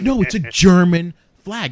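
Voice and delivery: loud, slow stress on "German"; insist, stretching the word "German"; insistent retold correction. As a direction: hit "German" hard, drawn out and high. Retold caller insisting on her point.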